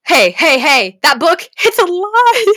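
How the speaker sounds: loud, high outraged denial; indignant protest. Real, not acted. To viewers about the book, loud indignant protest. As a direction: call out the book as a liar.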